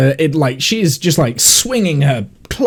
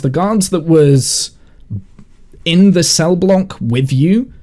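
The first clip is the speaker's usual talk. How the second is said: normal talking voice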